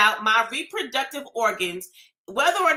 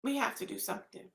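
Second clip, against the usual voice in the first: much softer, hushed and defeated; despairing plea; 3 semitones lower, 14 dB quieter, much faster than usual. Real, not acted. Pleading with people after the mass shootings, quiet despair. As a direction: drop to a near-broken plea, almost to yourself. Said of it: "I'm pleading. I'm begging".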